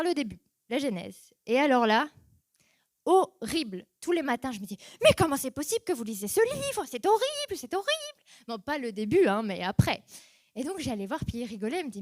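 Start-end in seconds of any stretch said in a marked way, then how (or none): 5.0-8.2 climbing ever higher in disbelief; exasperated complaint. Retold or imitated. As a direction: protest louder, pitch soaring. Exasperated reading Genesis.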